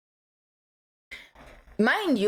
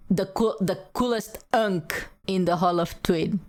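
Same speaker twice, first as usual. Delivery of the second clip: usual speaking voice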